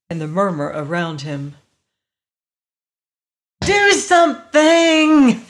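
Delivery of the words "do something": high, drawn-out desperate scream; loud, frantic plea. A narrator's reading. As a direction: scream it slowly at people who won't act. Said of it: "he screamed".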